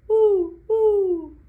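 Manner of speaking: high, loud playful owl hoot. Pretend. Reader hooting like an owl for children, high and loud. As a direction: hoot like a cheerful owl for the kids. Owl named as favorite pet.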